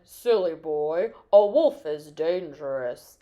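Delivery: put-on low, slow old-man scolding; stern grandfather. A narrator's reading. Grandfather tells Peter off about wolves, deep slow scolding. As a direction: warn deeply and slowly. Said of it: "His grandfather said".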